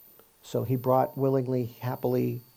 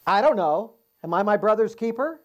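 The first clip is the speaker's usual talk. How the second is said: louder, higher, brushing the question off; a defiant, higher, louder "Am I my brother's keeper?"; defiant retort; 8 semitones higher, 6 dB louder than usual. Retold or imitated. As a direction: snap back at God like a guilty brother. Cain's retort to God.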